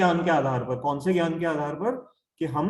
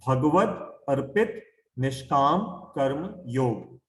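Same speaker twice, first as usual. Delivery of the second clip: spaced out slowly with pauses between words; 2 semitones lower, slower than usual. Shown as a demonstration. Teacher introducing a difficult new term to listeners, slowly. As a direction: say it slowly, one word at a time. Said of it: "मैं धीरे धीरे बोलूंगा".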